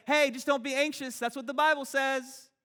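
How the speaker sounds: high, loud chirpy advice; hammed-up churchy pep talk; mock preachy cheer. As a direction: brush off their anxiety with a bright cliché. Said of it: "stand over here and say".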